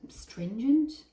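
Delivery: stringent? tried out with a questioning rise; testing the word stringent, rising up at the end; unsure word search. Real, not acted. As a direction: offer stringent as a hesitant guess. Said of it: "Yeah, strict".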